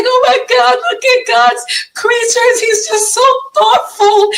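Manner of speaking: very high, swooning mock rapture; gushing over a bird. Mocking her own teary awe at a bird, gushing very high. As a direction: coo over the bird as if it were a miracle. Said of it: "and it's like".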